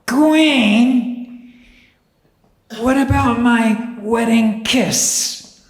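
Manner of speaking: high, loud, slow monster-bride voice; a hag's drawn-out call from the darkness; Lady Ragnell's voice. Acted. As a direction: stretch it out eerily from the dark. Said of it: "he heard Lady Ragnell's voice go".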